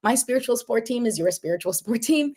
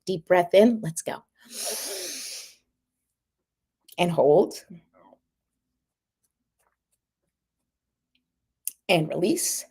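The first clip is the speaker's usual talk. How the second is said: guided breathing. Shown as a demonstration. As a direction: guide the breath in, hold, release. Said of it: "let's do that together".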